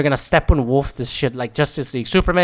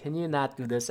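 much softer, understated plea; quiet weary objection; 13 dB quieter than usual. Retold or imitated. Viewer reacting to an overbearing movie moment, quiet weary objection. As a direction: say it under your voice, fed up.

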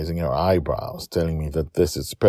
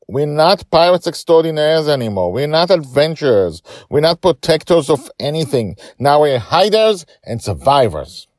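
louder, higher bitter shout; 11 semitones higher, 8 dB louder than usual. Acted. Justin shouts at his daughter Joy. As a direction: hammer every line at her. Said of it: "He shouts".

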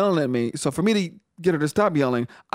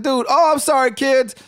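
higher, a bit louder sheepish sorry; mock startled apology; 9 semitones higher, 4 dB louder than usual. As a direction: play it as a caught-out quick apology. Apologizing to kids on speaker.

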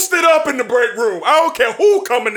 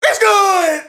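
excited shout; 7 semitones higher, slower than usual. Real, not acted. Calling the winning kick.

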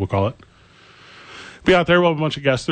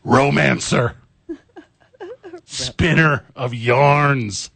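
mock dramatic accusation; much slower than usual. Mock accusing co-host of lying.

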